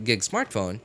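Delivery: normal talking voice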